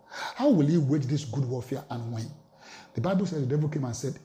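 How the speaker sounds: usual speaking voice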